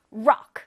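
Rock punched loud in one beat; stress on Rock. Shown as a demonstration. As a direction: hit the whole word Rock hard. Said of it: "크게 강세를 줘야". (Rock 전체에 크게 강세)

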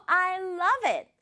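high-pitched delighted exclamation; lively, happy. Acted. Daughter hearing her mother's idea, lively happy voice. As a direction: draw it out high and joyful. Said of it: "활기차고 기분 좋은 목소리로".

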